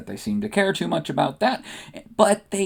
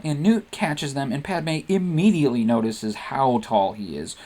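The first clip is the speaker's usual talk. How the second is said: plain reading voice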